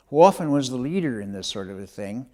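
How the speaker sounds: usual speaking voice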